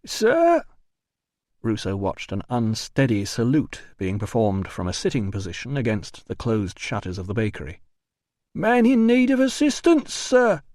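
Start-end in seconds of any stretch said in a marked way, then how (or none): 0.0-0.6 put-on very high drunken call. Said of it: "a voice called". 8.5-10.6 high, loud sloppy report; drunk mock report. Drunk porter salutes.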